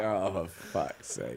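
exasperated. Retold or imitated. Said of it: "I was like".

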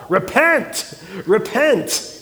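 mild mock street-preacher call; imitated calling out. Pastor mimicking a biker behind the van, calling out. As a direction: call it out like the biker, without a full shout. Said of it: "he would just call out".

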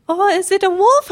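high, loud mom imitation; mother's voice. Mom guessing the dangerous animal, high imitated voice. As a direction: imitate mom's next guess, voice jumping up. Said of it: "she's just like".

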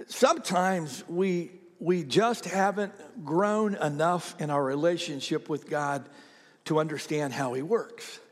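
normal talking voice

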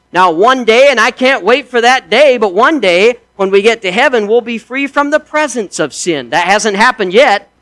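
a preacher's rising shout of hope; exultant preaching. Acted. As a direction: lift them toward heaven with joy.

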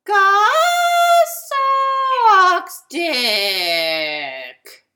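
high, very slow sung creak; creaky door voice. Shown as a demonstration. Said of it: "I creak over it and I yell insults at Gus".

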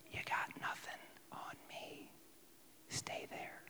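breathless hushed whisper; whispered taunt. Pretend. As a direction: whisper it, calm and unbothered. God voiced taunting the sea.

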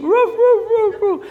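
high loud woofing like a yapping dog; dog barking. Retold or imitated. Preacher barking like the neighbor's dog at night. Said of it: "They keep".

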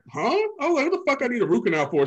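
high, fast, disbelieving question; incredulous shock. Retold or imitated. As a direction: react like you can't believe the bill. Shocked at dentist.

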